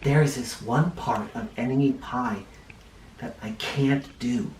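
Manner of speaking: softly, a father letting his son in on a secret; hushed and confiding; talking quietly. A narrator's reading. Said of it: "He talked quietly".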